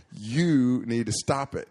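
slow, loud, deliberate warning; firm correction. Retold or imitated. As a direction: tell her firmly to quit.

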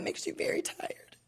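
tearful whisper. Real, not acted. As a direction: whisper it, worn out from crying. Whispered after crying.

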